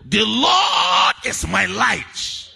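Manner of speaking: slow, loud call to repeat; shouted call. Shown as a demonstration. Preacher leading the congregation, a slow shouted call. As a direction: lead the church in the line. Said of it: "Can I hear somebody say".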